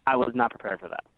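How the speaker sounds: normal talking voice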